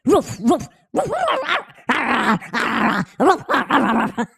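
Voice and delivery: loud, rough mock barking; dog barking. Teasing a viewer's dog that hates this voice, loud barking. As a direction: bark like a big excited dog.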